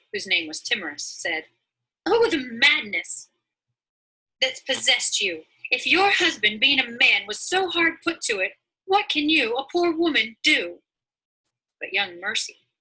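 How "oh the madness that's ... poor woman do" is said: high, loud dismayed neighbour voice; scolding neighbour. Acted. Timorous scolds Christiana for packing to leave, high and loud. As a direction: cry out at her folly, pitched high.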